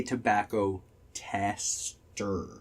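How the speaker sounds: "tester" said slowly with a full final r; forced pronunciation. Shown as a demonstration. Showing a friend the word he struggles with, carefully forced "tester". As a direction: pick apart "tester", syllable by syllable, ending on r. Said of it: "I can't say".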